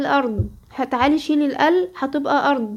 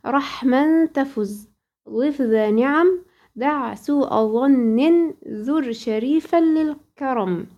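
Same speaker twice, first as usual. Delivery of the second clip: slow, measured chanting of the Tuhfat al-Atfal verses; slow verse recitation; much slower than usual. Shown as a demonstration. Teacher reciting the poem slowly to her student, measured chant. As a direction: intone each verse slowly, like a Quran teacher.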